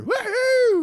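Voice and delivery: high, loud party whoops; celebratory whoop. Retold or imitated. Hunters celebrating finding the deer, loud high whooping. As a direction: holler a high-pitched woo-hoo. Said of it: "and then I hear".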